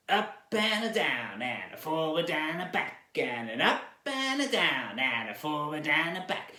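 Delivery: drawling cowboy with swooping pitch. Shown as a demonstration. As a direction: stretch it out like a cowboy. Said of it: "your best cowboy voice".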